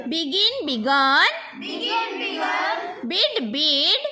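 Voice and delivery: slow, drawn-out teacher chant; measured classroom verb recitation; repeat-after-me drill. Shown as a demonstration. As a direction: stretch each word for the class to echo. Leads word repetition drill.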